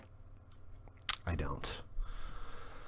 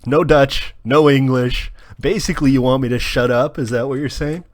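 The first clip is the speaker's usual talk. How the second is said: louder, higher, playful indignation; louder playful mock outrage; mock outraged protest; 6 semitones higher, 13 dB louder than usual. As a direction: protest the no-singing rule in a loud mock huff. Mock protest at chat's rule.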